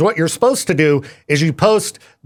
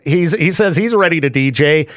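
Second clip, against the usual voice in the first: ordinary talk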